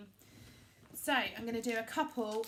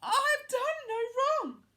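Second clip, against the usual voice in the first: much higher, louder, slow desperate cry; crying plea; 13 semitones higher, 10 dB louder, slower than usual. A narrator's reading. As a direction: plead innocence through tears. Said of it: "She cried again".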